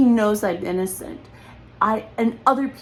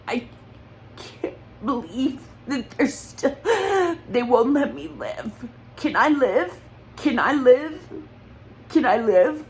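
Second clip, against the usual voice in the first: pleading through tears, barely holding on; breaking into tears; 3 semitones higher than usual. Real, not acted. Pleading with her attackers while crying, voice breaking. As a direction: voice cracking into real sobs, pitch rising. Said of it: "hard not to cry".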